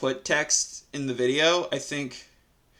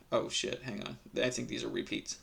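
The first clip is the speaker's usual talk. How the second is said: much softer, under the breath; muttered aside; 3 semitones lower, 11 dB quieter than usual. Real, not acted. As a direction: mumble it to yourself.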